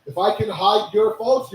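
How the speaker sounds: ordinary delivery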